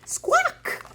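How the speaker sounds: very high, soft little squawk; parrot squawk. Shown as a demonstration. Storyteller shows children the parrot squawk, high and light. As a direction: squeak it out like a tiny parrot. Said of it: "go give a nice big squawk".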